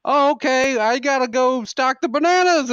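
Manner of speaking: very high, loud, awkward brush-off; panicked excuse. Retold or imitated. Grocery clerk escaping old lady, high panicked excuse. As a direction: blurt it high and flee.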